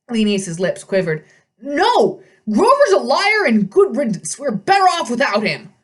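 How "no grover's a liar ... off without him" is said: put-on loud, high shouting old satyr; angry satyr. A narrator's reading. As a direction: shout the denial. Satyr denies angrily.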